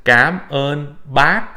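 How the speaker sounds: "cám ơn bác" said very slowly, "bác" rising; slow Vietnamese cám ơn bác. Shown as a demonstration. Teacher models the polite thanks to elders slowly. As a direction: spell it out slowly for the learner. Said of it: "it would be pronounced".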